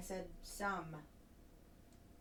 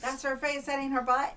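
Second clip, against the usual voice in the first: loud insisting; 6 semitones higher, 10 dB louder than usual. Real, not acted. Playful argument over a photo.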